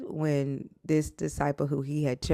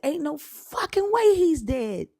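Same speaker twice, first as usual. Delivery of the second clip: disbelief; 14 semitones higher than usual. Retold or imitated. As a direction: refuse to believe it, wailing. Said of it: "I'm like".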